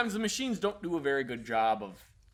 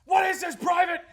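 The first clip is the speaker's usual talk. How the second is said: high, loud furious yell at a private; mock drill sergeant shout; 13 semitones higher, 7 dB louder than usual. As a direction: bark it at the private. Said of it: "Always spoke in a super deep voice".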